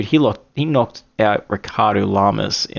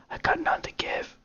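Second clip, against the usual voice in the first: breathy whispered confession; whispered aside; 10 dB quieter than usual. Real, not acted. Admitting no Aussie pride to viewers, whispered. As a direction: drop to a whisper for the punchline.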